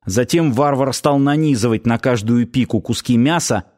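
ordinary delivery

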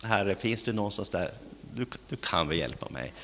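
soft and hesitant, barely daring to ask; small, quiet, half-hearted plea; timid mumbled prayer. Retold or imitated. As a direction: ask God as if afraid to bother him. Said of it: "Då säger man inte".

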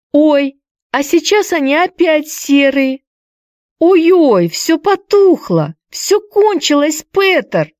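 high, slow rapture fading to dismay; marvel, then cry as it fades; awed then dismayed. A narrator's reading. (восторг, переходящий в огорчение)

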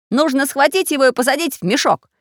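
high, loud, resolute voice; decisive. A narrator's reading. Samodelkin proposes bagging the villain, decisively. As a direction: urge the capture with firm energy. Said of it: "решительно сказал Самоделкин".

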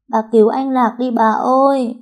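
high-pitched wailing appeal; loud crying plea. A narrator's reading. Girl begging grandma to save her friend, loud tearful plea. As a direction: wail for help like a desperate child. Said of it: "Cô khóc to".

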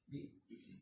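ba''isin, hamza held doubled; doubled hamza in بئس. Shown as a demonstration. Reader showing a variant, doubled hamza in بئس. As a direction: hold the hamza, then i in بئس. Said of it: "الباء مفتوحة وهمزة مشددة مكسورة".

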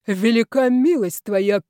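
loud, slow mock praise; obedient rejoicing. A narrator's reading. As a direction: exult dutifully, like a congregation answering. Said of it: "послушно возликовал Колчиновский". (с показным послушным ликованием)

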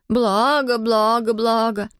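girl mimicking creature babble; mocking nonsense-word chant; creature imitation. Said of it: "А в ответ ей".

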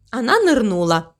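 ordinary delivery

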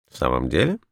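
low, offhand question with a rising end; careless. A narrator's reading. Holmes answers his friend's praise of the client, carelessly. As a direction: toss it off with mild indifference. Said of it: "небрежно проговорил он".

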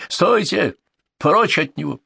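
high, sharp command to halt; commanding shout. Acted. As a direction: bark the order over the crowd. Leader shouts to stop fight.

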